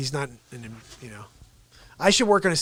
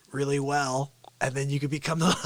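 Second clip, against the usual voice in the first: ordinary talk, nothing marked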